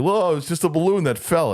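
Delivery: high, hammed-up dismissive voice; mock official excuse. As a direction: brush it off like a fake cover story.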